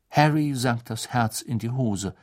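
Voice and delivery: ordinary delivery, nothing marked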